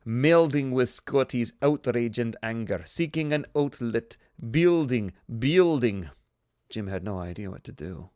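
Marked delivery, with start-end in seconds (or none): 0.1-6.1 bad Chekhov Russian accent rising. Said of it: "worst Chekhov and also the worst Russian accent".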